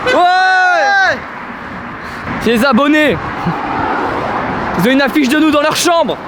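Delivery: high, excited bragging; hyped-up boast. Acted. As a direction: brag about the fans, pumped. Mock-hyped boast about subscribers.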